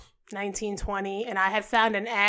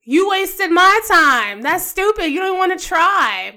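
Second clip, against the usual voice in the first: higher, louder, slow and emphatic; exasperated rebuke; 5 semitones higher, 7 dB louder, slower than usual. Real, not acted. Guest venting at her ex, loudly exasperated. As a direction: stretch each complaint out, indignant.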